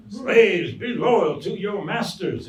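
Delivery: high, loud, slow Southern preacher sermonizing; loud, slow mock preacher in a Southern accent. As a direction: ham up a pompous Southern pulpit voice. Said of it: "perform as a Southern preacher".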